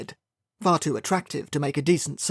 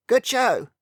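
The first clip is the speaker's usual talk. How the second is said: bright high exclamation, a bit louder; exclaimed; 8 semitones higher, 4 dB louder than usual. A narrator's reading. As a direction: cheer it out like a pleased old colonel. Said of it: "the colonel exclaimed".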